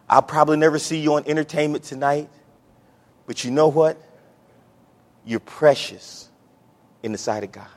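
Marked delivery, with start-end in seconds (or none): 5.2-7.7 tender. Real, not acted. Preacher tenderly telling each listener they matter. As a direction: let it land gently, like a secret.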